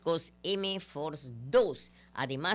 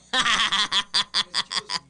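real laugh; 3 semitones higher, 5 dB louder than usual. Real, not acted. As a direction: open burst of laughter. Laughs at own joke.

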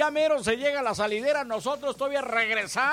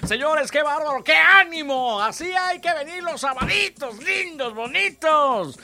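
loud, high burst of radio enthusiasm; excited cheer; 4 semitones higher, 6 dB louder than usual. Real, not acted. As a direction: cheer the listeners on. DJ cheering Saturday crowd. (euforia de locutor)

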